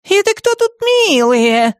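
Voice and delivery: very high, drawn-out indignation; bristling witch. A narrator's reading. Witch overhears Драко's mutter, bristling. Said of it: "тут же вскинулась она".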